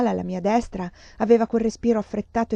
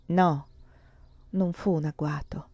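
whisper; 4 semitones lower, 4 dB quieter than usual. A narrator's reading. Narratore sussurra la negazione.

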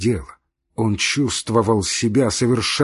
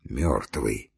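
very low, soft, understated bitterness; mocking, angry; 10 semitones lower, 6 dB quieter than usual. A narrator's reading. Dying man to Marya, weakly mocking and cross. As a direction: sneer it weakly under the breath. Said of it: "сказал он насмешливо, сердито".

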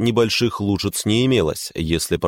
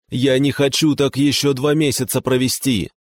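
high desperate cry; shouting; 7 semitones higher than usual. A narrator's reading. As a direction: cry out at the end of his rope. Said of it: "закричал Жора".

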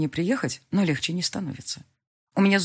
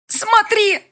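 higher, louder shriek; furious shriek; 9 dB louder than usual. A narrator's reading. Elvira shrieking at the heroine, furious. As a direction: thrust it at her. Elvira shows bloody hands.

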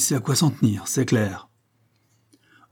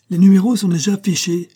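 higher and louder, pointing it out eagerly; excited exclamation; 8 semitones higher, 7 dB louder than usual. A narrator's reading. Narrator calls out to Holmes, excited. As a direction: cry it out in sudden excitement. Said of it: "m'écriai-je".

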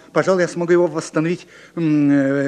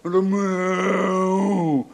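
imitating a bull. Shown as a demonstration. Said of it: "ревёте, как бык".

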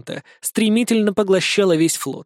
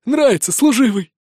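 higher, fawning voice; fawning merchant; 5 semitones higher than usual. A narrator's reading. Merchant fawns over the vampire, higher and ingratiating. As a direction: flatter him wearily. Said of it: "лебезил устало линялый".

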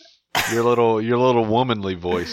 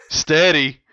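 mock sultry steady with a louder, higher push on "steady."; "steady." said louder and higher, like a cheeky innuendo; suggestive steady; 6 semitones higher, 4 dB louder than usual. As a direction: lean into "steady." with a hammed-up suggestive lilt. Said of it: "you're telling somebody to steady".

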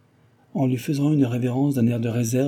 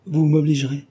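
a raised, urgent exclamation; mild exclamation; 6 semitones higher, 5 dB louder than usual. A narrator's reading. Madame Delville insists Cécile let her return, exclaiming. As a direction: cry it out a little higher and louder, earnestly. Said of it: "s'écria madame Delville".

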